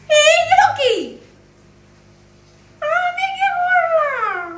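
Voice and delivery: high, slow, wounded what-did-I-do; mock innocent. Owner protests to the snappy dog in a mock wounded whine. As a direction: plead innocence in a stretched high voice.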